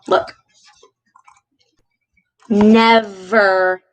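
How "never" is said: "Never" stretched on a buzzing v in the middle; slow, teacherly "Never" with the v held; drawn-out V in Never. Shown as a demonstration. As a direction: linger on the V in "Never". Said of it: "That's V in the middle of that word".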